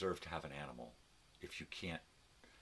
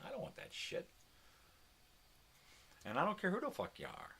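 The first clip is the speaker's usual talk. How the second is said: angry rant; 14 semitones higher than usual. Real, not acted. Ranting about the screaming neighbor, high and angry. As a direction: voice jumps much higher in disgust.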